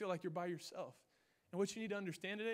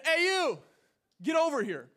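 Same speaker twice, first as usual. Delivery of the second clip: loud, high summoning yell; bossy shout across the lot; calling out; 8 semitones higher, 11 dB louder than usual. Retold or imitated. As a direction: holler to get him over. Yelling at the stranger again.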